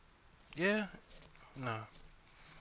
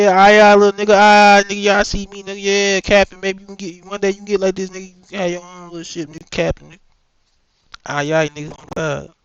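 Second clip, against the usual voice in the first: louder, cocky shout-out voice; swaggering boast; 5 semitones higher, 11 dB louder than usual. Pretend. Playing a boastful crew member, loud and cocky. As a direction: strut and brag loudly. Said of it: "Maybe that's how it happened".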